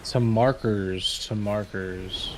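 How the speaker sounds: very slow, stretched call; drawn-out callout. Real, not acted. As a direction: stretch each word out slowly.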